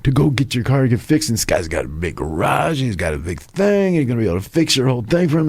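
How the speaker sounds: high, eager helpful friend, rushing at the end; eager buddy. Retold or imitated. Buddy eagerly telling his friend about a nearby garage, high and quick. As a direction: pitch the mechanic like great news. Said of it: "He goes".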